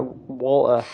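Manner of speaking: "Walter" as "Wol-uh", no T sound; Walter without the T. Shown as a demonstration. Streamer showing they can't say "Walter", T dropped. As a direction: say "Walter" with the T missing. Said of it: "I can't say the name Walter with the T in it".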